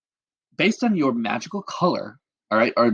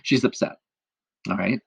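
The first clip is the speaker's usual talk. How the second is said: ordinary delivery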